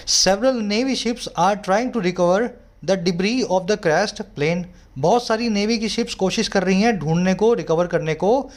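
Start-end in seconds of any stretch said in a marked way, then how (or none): none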